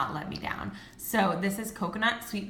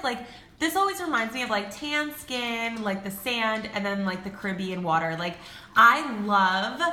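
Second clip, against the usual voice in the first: ordinary delivery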